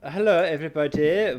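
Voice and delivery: put-on high, loud German-accented greeting; weird German accent. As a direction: call out hello in a mock German accent. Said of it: "weird German accent".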